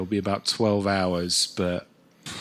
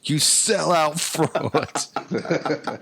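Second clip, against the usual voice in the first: slow, louder hammed-up heckle, higher; mock heckler; 8 semitones higher, 4 dB louder, much slower than usual. English fan jeering the comedian, slow and hammed-up. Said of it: "That's not even your real accent".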